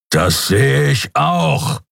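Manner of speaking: very slow, high, lightly voiced annoyed captain; growling. A narrator's reading. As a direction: grumble it out, irritated and drawn out. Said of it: "grollte Sron".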